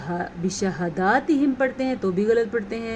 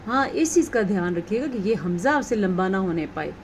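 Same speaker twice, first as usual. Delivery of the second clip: normal talking voice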